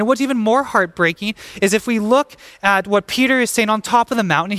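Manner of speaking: normal talking voice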